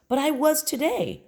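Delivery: put-on high young boy's voice, a bit defiant; child's voice. Boy admitting to his mom he blurted today, in a high child's voice. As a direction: pitch it up like a little kid owning up.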